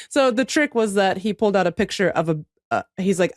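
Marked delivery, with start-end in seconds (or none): none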